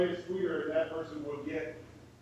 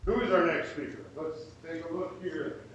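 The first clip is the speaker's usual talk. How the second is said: ordinary delivery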